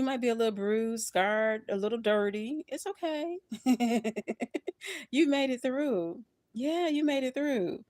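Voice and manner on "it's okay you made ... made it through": emotional reassurance. Real, not acted. Consoling viewer, emotional.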